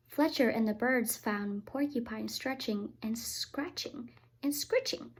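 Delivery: plain reading voice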